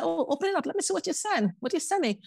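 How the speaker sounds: high-pitched, nosy demanding voice; pushy cousin. Retold or imitated. Woman imitating her nosy cousin, high and insistent. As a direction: mimic the cousin pressing to see inside. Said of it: "She said".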